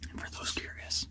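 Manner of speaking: hushed whisper so game can't hear. Real, not acted. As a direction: keep it to a whisper in the blind. Hunter whispering in blind.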